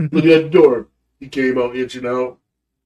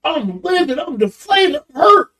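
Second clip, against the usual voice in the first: worked-up hurt; 12 semitones higher, 5 dB louder than usual. Retold or imitated. Retelling his anger at son.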